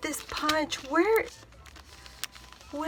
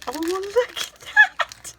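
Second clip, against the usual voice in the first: delighted; 7 semitones higher, 5 dB louder, slower than usual. Real, not acted. Delighted at coin purse.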